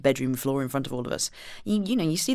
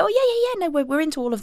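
breezy agreement; 12 semitones higher, faster than usual. Retold or imitated. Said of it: "they go".

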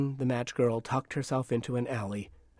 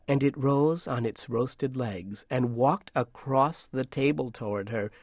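plain reading voice

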